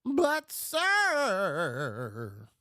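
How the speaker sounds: high, loud pleading "But, sir"; whiny protest. Retold or imitated. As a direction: protest like a nervous assistant. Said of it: "but, sir" guy".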